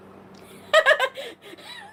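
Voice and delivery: a long, high laugh rising in pitch. Real, not acted. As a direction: burst out laughing high at your own joke. Laughs at own insult.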